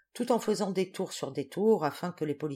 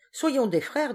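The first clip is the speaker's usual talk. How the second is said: fervent; 8 semitones higher, 5 dB louder, slower than usual. A narrator's reading. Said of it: "dit-il, les yeux levés au ciel".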